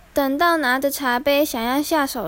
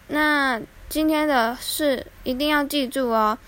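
ordinary delivery, nothing marked

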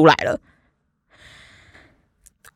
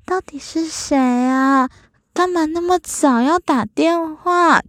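high, slow, sultry sleepy woman; 模仿娇喘的女生声音，高而慢; breathy woman's voice; 9 semitones higher, slower than usual. Retold or imitated. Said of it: "很娇喘的女生的声音". (用高而慢的娇喘女声抱怨被吵醒)

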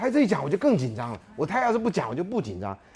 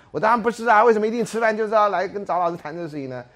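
indignant retort; 8 semitones higher, 9 dB louder than usual. Retold or imitated. Student objects to teacher.

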